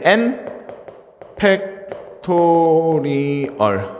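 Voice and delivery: 팩토리얼 stretched out slowly, syllable by syllable; drawn-out reading. Shown as a demonstration. Teacher introducing how to read the symbol, 팩토리얼 drawn out. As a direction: hold each syllable of 팩토리얼 long and level. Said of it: "얘는 뭐라고 읽냐면은".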